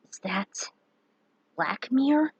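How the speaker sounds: hushed whisper of wonder; awed whisper. Acted. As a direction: breathe it out in awe. Awed question at the image.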